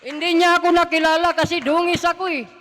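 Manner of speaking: emotional loud testimony; loud emotional outburst. Real, not acted. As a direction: cry it out loud and high. Recalls daughter not recognizing him.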